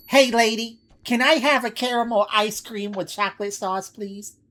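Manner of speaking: high, loud woman's voice; female customer voice. Acted.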